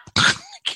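laugh. Real, not acted. Laugh after mock shout.

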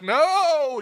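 hammed-up high protest; emphatic no. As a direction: fire back a stubborn no. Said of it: "And she's like".

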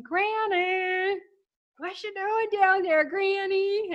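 high teasing call up from the balcony; kids screaming up at grandma. Retold or imitated. Kids on the balcony taunting grandma by the pool, high teasing yell. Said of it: "And we would scream".

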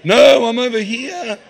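sing-song hide-and-seek taunt; playful hiding God. Pretend. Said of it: "hide behind a tree and go".

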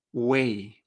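"wei" low with a clear e; correct pronunciation. Shown as a demonstration. Teacher reminds learners how to read ui, correct pronunciation. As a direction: glide "wei" through u-e-i. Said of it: "tenemos que acordarnos de".

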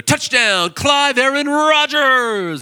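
high, drawn-out, shouted touchdown call; a kid yelling his own name in triumph after a score; kid's touchdown cheer. Retold or imitated. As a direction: announce yourself like a stadium announcer. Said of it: "and he'd go".

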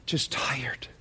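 a weary hush; whispered. Real, not acted. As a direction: breathe the word tired out in a whisper. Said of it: "many are tired".

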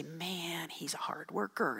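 soft whispered wish; hushed whisper. Retold or imitated. Mother whispers the praise she hoped to hear. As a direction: whisper it like a longed-for compliment.

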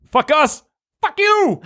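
high-pitched mock yell. A narrator's reading. Matt at the door to the listener, yelling. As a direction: read as a high, strained shout. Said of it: "Matt stares me in the eyes and yells".

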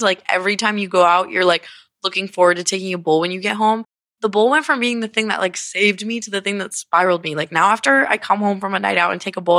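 ordinary delivery

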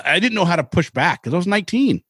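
normal talking voice

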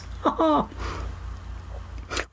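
soft, breathy laugh. Real, not acted. Host amused by own calorie remark, soft laugh.

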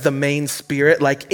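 ordinary talk, nothing marked

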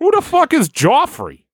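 high-pitched Boston complainer; Boston accent. Acted. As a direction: gripe in an exaggerated, squeaky-high Boston accent. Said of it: "that has that real accent".

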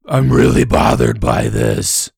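deep, slow caveman growl; angry caveman voice. Acted. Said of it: "In a really angry caveman voice".

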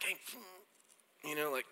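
hushed, discreet "hey"; quietly tip off a friend. Acted. Nice friend discreetly flagging food in a tooth, hushed. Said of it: "really nice and is discreet".

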